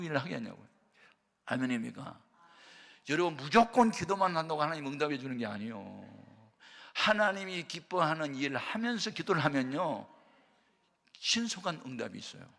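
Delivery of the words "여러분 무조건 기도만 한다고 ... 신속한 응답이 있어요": raised, forceful pulpit voice; emphatic preaching. Real, not acted. Preacher telling the congregation how prayer gets answered, forceful exhortation. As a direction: drive the teaching home to the crowd.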